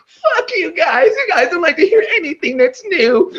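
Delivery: very high, whiny squealed voice; mock tantrum. Losing rapper scolding the crowd, mocking high whine. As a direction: imitate a sore loser wailing in falsetto.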